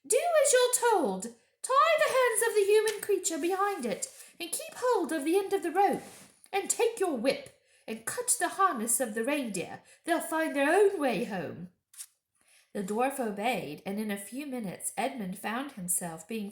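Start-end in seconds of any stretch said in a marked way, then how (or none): 0.0-11.7 high, loud commanding witch voice; witch giving orders. A narrator's reading. Witch ordering the dwarf to bind Edmund, high and loud. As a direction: rap out orders like a tyrant. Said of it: "said the witch".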